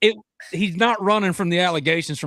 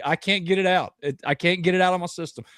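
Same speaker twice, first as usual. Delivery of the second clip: ordinary talk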